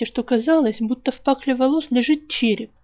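plain reading voice

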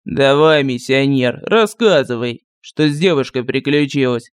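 lightly voiced village sorcerer; gruff sorcerer voice. A narrator's reading. Sorcerer voice held. (ворчливый голос колдуна)

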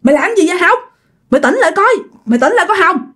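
high-pitched, urgent calling to someone; urgently calling her back; urgent pleading. Acted. Others urge possessed woman to wake.

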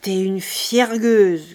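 slow, loud, menacing growl; slow angry rumble; growled threat. A narrator's reading. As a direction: growl the insult, drawn out. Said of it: "gronda-t-il".

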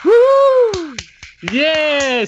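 whooping yell; loud cheering. Real, not acted. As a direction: cheer at the top of your voice. Cheering Vicky's paycheck.

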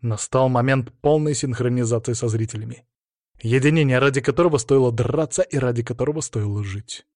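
ordinary delivery, nothing marked

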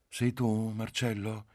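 caressing, dreamy question; caressing, languid wife. A narrator's reading. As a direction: purr it tenderly, like a doting wife. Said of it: "la voce carezzevole, languida della moglie".

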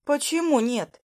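high, indignant question; protesting. A narrator's reading. Heroine questions Lucas's refusal, protesting. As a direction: challenge the refusal, miffed.

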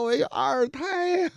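high, slow, wailing complaint; mock wailing. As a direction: drag it out like a weeping drunk. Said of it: "哭的呀". (学醉汉慢慢哭诉，声音高)

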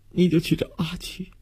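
high, choked sobbing plea; 强烈抽泣. A narrator's reading. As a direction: sob through it, words coming out in broken bursts. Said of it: "也被强烈的抽泣打乱，". (哭着断断续续地嘱托)

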